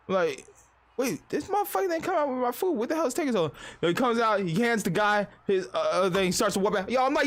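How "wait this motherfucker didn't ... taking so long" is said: high-pitched mock indignation; indignant disbelief. Customer fuming over his late food, indignant. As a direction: pitch it up in incredulous complaint. Impatient inner reaction while waiting.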